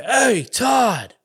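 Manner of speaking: retold shout to a passing kid; calling out. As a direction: call out to someone passing on the street. Calling to Todd rolling by.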